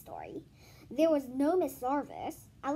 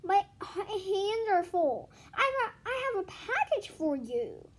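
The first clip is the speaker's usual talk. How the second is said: strained; 7 semitones higher, 3 dB louder than usual. Acted. Boy at the door, straining under the heavy box. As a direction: push the words out while straining.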